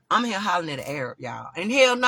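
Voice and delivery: ordinary talk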